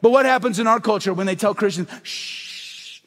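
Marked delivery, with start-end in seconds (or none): 2.0-3.0 sharp unvoiced shush; hushing shh. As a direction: silence them with a hiss. Culture silencing Christians.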